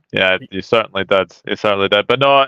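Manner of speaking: usual speaking voice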